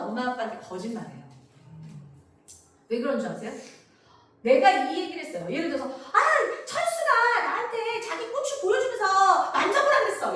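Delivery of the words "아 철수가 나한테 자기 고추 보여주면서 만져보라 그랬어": put-on high, slow child voice with a laugh; a child laughing as it tells the story; child telling. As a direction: giggly kid reporting casually. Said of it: "이렇게 얘기했어요".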